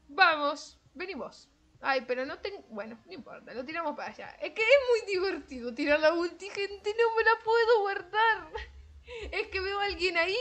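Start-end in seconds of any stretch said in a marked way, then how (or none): 4.4-10.3 gleeful excitement. Real, not acted. Gleeful about spamming her ultimate.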